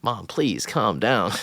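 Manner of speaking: slow, half-hearted mock plea; drawn-out, dismissive joking tone; jokey imitation. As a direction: imitate a son jokingly brushing off his mom. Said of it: "super jokey, like".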